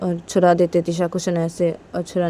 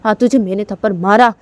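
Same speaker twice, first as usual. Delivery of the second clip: much higher, building to a furious yell; angry brother; 12 semitones higher, 4 dB louder than usual. Retold or imitated. Brother yelling at Tisha for choking Shanaya, furious. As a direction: scold her, rising to an outburst. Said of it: "Tisha के भैया बोलते हैं".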